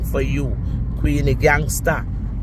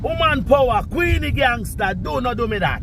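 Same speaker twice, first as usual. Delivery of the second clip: high pleading rant; 10 semitones higher than usual. Real, not acted.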